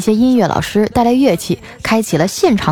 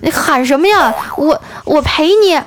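higher voice, slowing to a stammer; lightly acted timid offer, faltering on 我; mock trembling reply; 7 semitones higher than usual. Flowerpot dropper answers the shouting car owner, mock timid stammer. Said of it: "我颤颤巍巍的啊".

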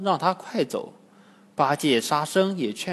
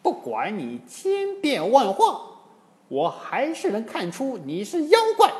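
angry shout; 8 semitones higher than usual. A narrator's reading. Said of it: "喝道".

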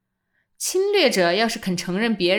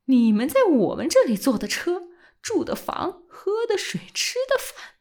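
rising, fervent defiance, climbing high; impassioned outburst; 7 semitones higher than usual. A narrator's reading. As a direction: pour it out in a rising passion. Old man's impassioned patriotic outburst. (激昂地一气说完)

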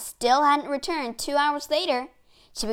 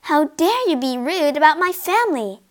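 put-on raised, indignant child; indignant cry; 6 semitones higher, 7 dB louder than usual. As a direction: snap back, offended. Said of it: "cried Virginia".

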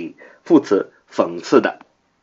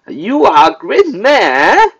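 slow, high mock question, rising on man; sarcastic; 11 semitones higher, 5 dB louder, much slower than usual. Shown as a demonstration. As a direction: question their greatness with exaggerated irony. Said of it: "I asked sarcastically". (讽刺地拖长音反问)

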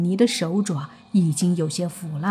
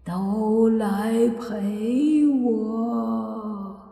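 ghost; much slower than usual. Acted. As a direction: stretch the words out like a ghost. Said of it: "那鬼说着".

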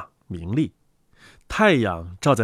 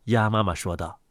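ordinary delivery

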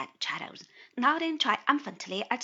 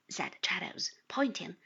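ordinary delivery, nothing marked

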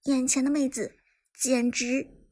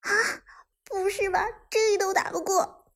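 put-on higher-pitched girl's voice, dismayed; high, whiny self-talk after a lost fight; girl muttering to herself; 9 semitones higher than usual. A narrator's reading. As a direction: voice a girl panting and complaining to herself. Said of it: "一个女孩的喘息声和自言自语".

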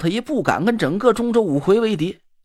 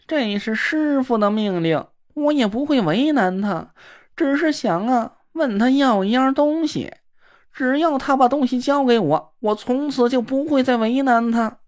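simpering sissy man; 5 semitones higher than usual. Acted. Na Ruolan fawning after the girl who ignores him, simpering. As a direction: coo at her like a fawning dandy.

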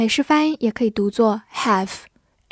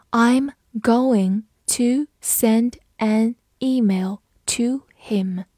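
very slow, separated words; slow read-through; much slower than usual. Shown as a demonstration. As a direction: read it one word at a time for learners. Teacher's slow read, then normal. (一字一顿地慢读)

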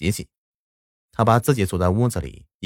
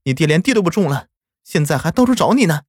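higher-pitched scolding mother; 8 semitones higher than usual. Acted. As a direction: scold the runaway child. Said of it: "娘就责怪我说道".

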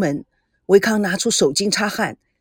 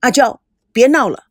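louder, a sharp rebuke; loud scolding; 5 dB louder than usual. Acted. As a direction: cut her off firmly. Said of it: "赵康大声的斥喝".

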